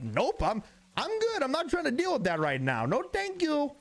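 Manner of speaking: high, airy no-thanks voice; breezy brush-off. Pretend. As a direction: wave it off lightly and cheerfully. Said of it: "I'm like".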